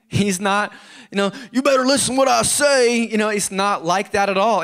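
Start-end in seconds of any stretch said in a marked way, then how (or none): 1.5-3.0 bossy tyrant god. Pretend. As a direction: play a domineering master barking orders. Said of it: "he's not power tripping".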